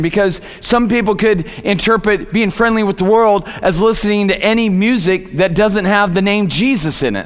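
ordinary delivery

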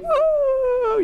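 shrill falsetto whoop; a squealed falsetto woo in mockery; hammed-up high whoop. Mocking the gushing lady.

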